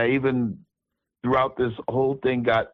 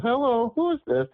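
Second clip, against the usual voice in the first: a light caricature of the sister picking up; high mock phone voice; 14 semitones higher than usual. Sister answering the phone, in a high mock voice. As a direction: pitch it way up like a woman answering the phone.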